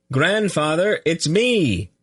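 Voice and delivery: high, carrying call. A narrator's reading. Boy calling up to his grandfather, high call. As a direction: call up to the windows, hopeful.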